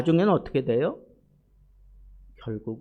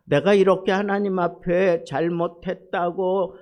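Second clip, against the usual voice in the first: usual speaking voice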